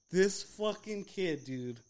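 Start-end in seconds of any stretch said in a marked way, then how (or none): none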